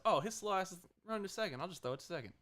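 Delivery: casual pitcher aside. Retold or imitated. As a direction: say it like it's no trouble at all.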